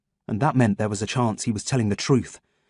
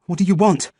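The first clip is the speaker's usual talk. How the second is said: breathless, higher, pushed out between gasps; winded, higher demand; panting; 7 semitones higher, 3 dB louder than usual. A narrator's reading. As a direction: gasp it out after running. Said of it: "I panted".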